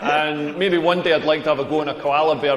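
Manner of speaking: ordinary talk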